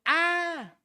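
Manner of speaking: loud, high anguished "ah"; long high yell of a demon; demon's cry. Acted. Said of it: "que dio voces diciendo".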